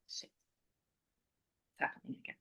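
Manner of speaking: near-whispered, voiceless recollection; hushed dread. Retold or imitated. Woman reliving the sight of blood, hushed dread. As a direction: let it out under your breath, barely audible.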